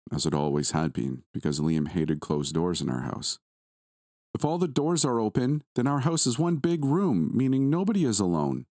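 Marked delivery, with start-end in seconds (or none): 4.3-8.7 put-on high, grinning little boy; son's voice with a grin. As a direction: voice the boy proudly, smiling through it. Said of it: "him saying those words with the widest grin".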